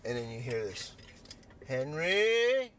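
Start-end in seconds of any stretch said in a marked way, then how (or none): 1.7-2.7 drawn-out rising call, pitched up. Retold or imitated. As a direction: imitate summoning someone from afar. Jerry calls out for Henry.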